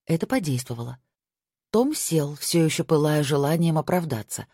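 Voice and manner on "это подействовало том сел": usual speaking voice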